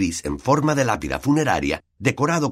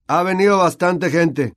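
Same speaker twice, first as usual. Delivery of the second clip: higher, louder, puffed-up pride; proud; 10 semitones higher, 4 dB louder than usual. A narrator's reading. Nick boasting about his party, proud. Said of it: "dijo con orgullo Nick".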